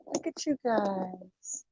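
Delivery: soft, slow cooing to the cats; cooing to pets. Real, not acted. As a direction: hush it down for sleeping kittens. Cooing to her sleeping cats.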